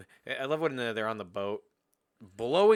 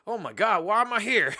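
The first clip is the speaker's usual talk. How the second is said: high, loud bewildered squawk; confused revived bum; 9 semitones higher, 8 dB louder than usual. Retold or imitated. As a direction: wake up baffled and yelp it. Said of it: "he's like".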